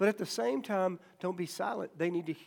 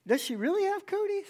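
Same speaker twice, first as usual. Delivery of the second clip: put-on high-pitched puzzled kid question; curious doubt; 11 semitones higher than usual. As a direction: wonder aloud, pitch climbing high. Child starts doubting cooties.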